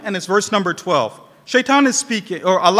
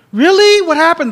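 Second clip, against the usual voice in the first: put-on a high, louder child's cry of disbelief; upset boy; 7 semitones higher, 7 dB louder than usual. A narrator's reading. Preacher voicing the little boy, shocked and upset. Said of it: "The boy starts crying".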